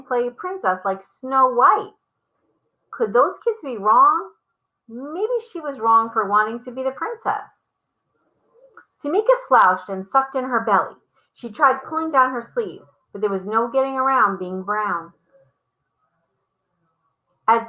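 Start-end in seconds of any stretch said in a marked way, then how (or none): none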